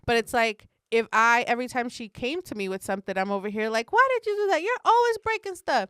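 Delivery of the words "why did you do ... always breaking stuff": high, loud mock scolding; scolding overreaction. Parent overreacting to daughter's confession, mock scolding. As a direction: nag a child, hammed up. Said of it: "you have big reactions".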